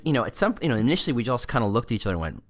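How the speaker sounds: usual speaking voice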